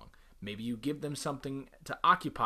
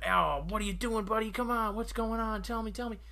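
much higher, fussing coax; mock doting parent; 9 semitones higher, 3 dB louder than usual. Parent rewarding son's tantrum, higher coaxing voice. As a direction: coo at the tantrum, pitched way up. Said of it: "and I go".

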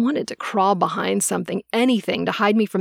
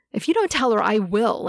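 ordinary delivery

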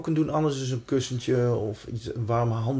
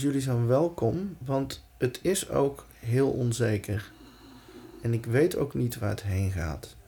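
soft, reassuring meditation voice; guided meditation; 4 dB quieter than usual. Acted. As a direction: calmly guide them into safety. Guides breathing and self-talk.

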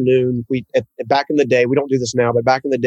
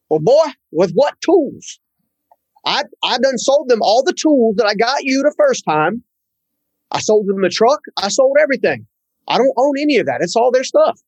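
put-on Southern accent; 10 semitones higher than usual. Boss doubting the young worker, in a high Southern accent. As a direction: sound like the North Carolina boss with a Southern accent. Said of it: "A good old Southern guy from North Carolina".

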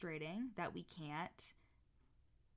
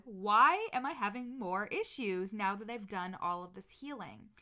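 exasperated past self; 2 semitones higher, 9 dB louder than usual. Retold or imitated. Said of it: "I was like".

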